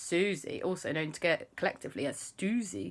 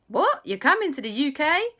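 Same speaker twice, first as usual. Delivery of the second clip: high, loud, rising disbelief; astonished; 10 semitones higher, 6 dB louder than usual. Acted. Auntie hears of uncle's visit, astonished. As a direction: exclaim at surprise news.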